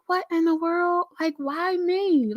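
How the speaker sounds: high, slow, baffled wonder; disbelief. Retold or imitated. To her friend, wondering why me, high amazed voice. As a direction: marvel that anyone would pick you. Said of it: "And I'm like".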